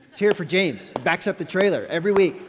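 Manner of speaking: loud, high cheer for a hero; rousing shout-out. Real, not acted. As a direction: call for applause, big and loud. Rallying applause for James.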